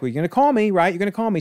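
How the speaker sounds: cling to the promise nervously; a needy kid begging not to be left; anxious teen. Acted. Teen pleads to be called.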